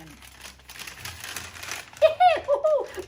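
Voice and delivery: very high squealing giggle; puppet giggle. Pretend. Leprechaun puppet giggling as it arrives.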